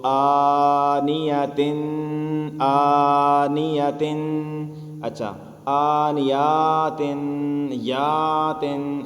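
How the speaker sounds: آنیاتن chanted slowly with the تن held in the nose; nasal hold. Shown as a demonstration. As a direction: keep the sound in the nose a moment on آنیاتن. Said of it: "آواز کو ناک کے اندر". (آنیاتن میں آواز ناک میں روک کر)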